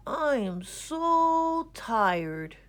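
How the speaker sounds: very slow, high, drawn-out tired moan; sleepy moan. A narrator's reading. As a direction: drag it out like you can barely wake. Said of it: "Gregory moaned".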